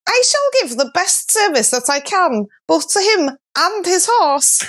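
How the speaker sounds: a high, lilting North Wales accent. Shown as a demonstration. Reading the maiden's line to co-hosts in a North Wales accent. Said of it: "Let me do the North Wales accent".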